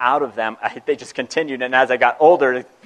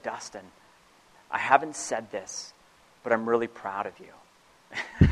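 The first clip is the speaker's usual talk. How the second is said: much softer, slow and gentle; tender father; 3 semitones lower, 8 dB quieter, slower than usual. Retold or imitated. Father sitting on son's bed, softly and slowly proud. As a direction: say it like a dad quietly opening up.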